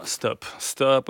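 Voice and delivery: ordinary talk, nothing marked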